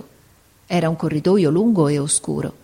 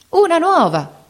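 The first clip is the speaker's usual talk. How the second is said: high, loud cry of a woman at table; loud call; 11 semitones higher, 3 dB louder than usual. Acted. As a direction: call out the newcomer loudly. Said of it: "disse forte una di loro". (grido forte e acuto)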